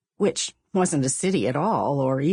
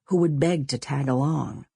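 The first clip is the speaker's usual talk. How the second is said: plain reading voice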